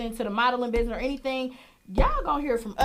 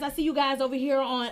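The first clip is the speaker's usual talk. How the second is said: ordinary talk, nothing marked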